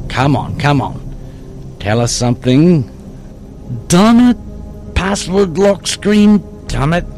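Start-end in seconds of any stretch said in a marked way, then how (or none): 3.9-7.0 frustrated cursing. Acted. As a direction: curse the stubborn phone, pitch jumping up. Dave hits a locked screen.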